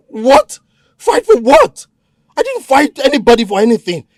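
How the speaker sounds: stunned, high-pitched denial; shocked protest. Acted. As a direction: cry out in disbelief. Captive protests the accusation.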